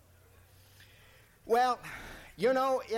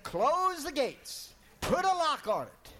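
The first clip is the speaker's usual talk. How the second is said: put-on high, alarmed command from the old devil; devil's urgent order; 9 semitones higher, 5 dB louder than usual. Old devil orders his imps to bar John out, high alarmed shout. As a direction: bark it out in a panic, high and pressed.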